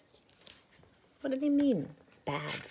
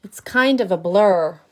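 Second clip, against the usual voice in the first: grumpy bad seed; 3 semitones higher, 15 dB louder than usual. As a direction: boast about being bad, loud. Seed's line.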